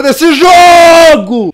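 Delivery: loud slowed-down yell; furious shout. Real, not acted. Gamer raging at the game's creator, shouting. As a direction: blow up at the developer.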